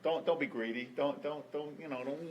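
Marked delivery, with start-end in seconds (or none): none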